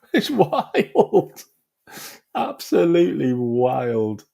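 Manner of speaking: high, cracking up, then drawn out; real laughing disbelief; laughing amazement. Real, not acted. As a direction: marvel through the laughter. Comments while still laughing.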